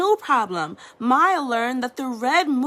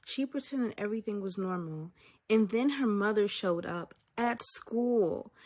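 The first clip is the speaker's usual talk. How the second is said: usual speaking voice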